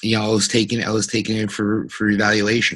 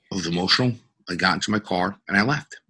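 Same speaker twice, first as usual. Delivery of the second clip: ordinary talk, nothing marked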